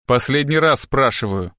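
plain reading voice

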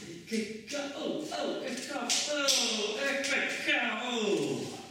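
high, jolting half-words, dragged out; the dreamer wakes with a start, stammering; startled waking. Acted. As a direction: jolt awake, can't finish the word. Waking up shocked and confused.

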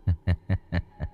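low, very soft ghostly chuckle; eerie soft chuckle. A narrator's reading. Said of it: "nó cười cười".

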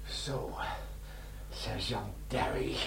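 soft, slow, hushed dismay; shocked whisper. Acted. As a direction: whisper it, seeing trouble. Recognizes rival in hospital. (geschokt gefluisterd)

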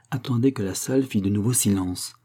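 usual speaking voice

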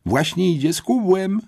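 high, gossipy schoolgirl cry; exclaiming. A narrator's reading. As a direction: call the others to look, voice jumping up. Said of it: "wykrzyknęła". (podniecony okrzyk plotkującej uczennicy)

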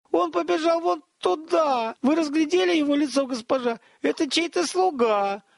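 agitated high-pitched hollering; cook's yelling. A narrator's reading. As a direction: call out excitedly in a high voice. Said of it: "заорал мастер-повар".

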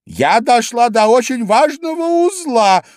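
high, loud indignant squawk; indignant mouse. A narrator's reading. Mouse corrects inattentive Alice, indignantly.

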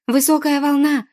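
high, loud alarm cry; yelled warning. A narrator's reading. Poma warning the boy of a huge wave, yelled warning. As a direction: yell it out across the roar of the sea. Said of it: "заорала Пома".

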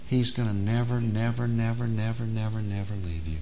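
soft, fading chain of never; hushed nevers. Acted. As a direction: let the nevers trail off softly. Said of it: "repeated nevers, softer".

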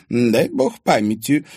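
slow, thoughtful recall; old woman trying to remember; pensive. A narrator's reading. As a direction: search your memory aloud. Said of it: "задумчиво".

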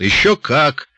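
loud, high, confident confirmation; emphatic assurance. A narrator's reading. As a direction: assure him with full emphasis. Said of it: "заверил его Джо".

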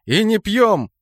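high-pitched emphatic call; shouted order. A narrator's reading. Leader stopping squad from drinking potions, shouted order. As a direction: shout the warning to everyone. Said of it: "закричал я".

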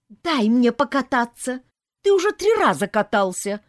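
put-on high, loud kids' quarrel; squabbling children. A narrator's reading. Children fighting over a ride in the kitchen, squabbling. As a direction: demand a turn like an indignant child.

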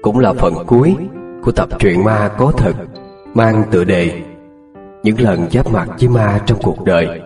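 measured radio announcer read; announcer intro. Acted. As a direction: present the episode like a host. Channel intro over music.

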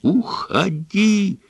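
high, slow Уходи, screamed out. A narrator's reading. Son screams at his father to go. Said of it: "завопил Брайан".

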